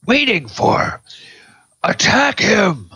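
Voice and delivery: high, loud bellowed order; bellowing. Acted. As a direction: bellow it at the ogres. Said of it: "she's bellowing that at the".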